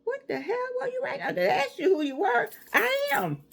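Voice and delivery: high, mock-outraged disbelief; incredulous. Real, not acted. Mocking test-takers who wrote a whole book, high and outraged. Mocking others' long answers.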